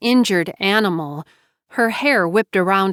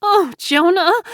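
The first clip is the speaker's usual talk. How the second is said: falter, then wail the friend's name; distraught, breaking into anguish; crying out; 5 semitones higher than usual. A narrator's reading. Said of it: "JoBeth cried".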